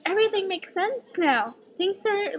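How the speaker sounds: high-pitched imitation of a friend catching on; acted high-voiced epiphany; friend's realisation. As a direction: mimic a friend having it all click, pitch lifted.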